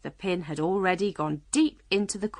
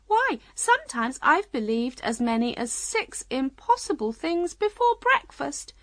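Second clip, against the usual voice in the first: high, emphatic Queen voice; 7 semitones higher than usual. A narrator's reading. Queen boasting of believing impossible things, proud. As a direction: play a proud Queen, pitched up.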